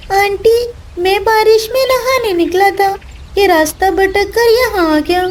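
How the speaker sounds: high little chick explaining; a lost child owning up to auntie. Acted.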